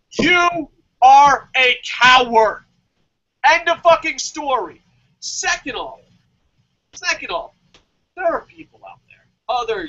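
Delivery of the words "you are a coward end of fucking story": slow, loud furious verdict; enraged final judgment; angry shout. Real, not acted. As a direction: spit the insult out slowly.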